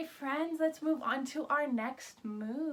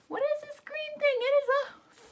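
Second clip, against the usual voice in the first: much higher, playful wonder; high teacher voice for kids; high curious question; 13 semitones higher, 6 dB louder than usual. Shown as a demonstration. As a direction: ask like you really wonder. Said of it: "quiz reveal".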